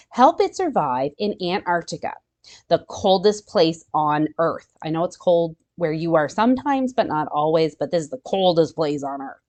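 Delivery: ordinary talk, nothing marked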